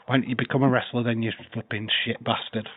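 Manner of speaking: normal talking voice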